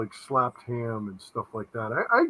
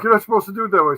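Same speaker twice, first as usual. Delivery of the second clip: higher, louder retold objection; raised-voice quoted complaint; mock scolding; 5 semitones higher, 6 dB louder, much faster than usual. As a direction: imitate people telling you off. Retelling others' scolding objection.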